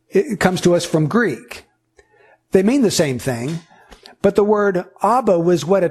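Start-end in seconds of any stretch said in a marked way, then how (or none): none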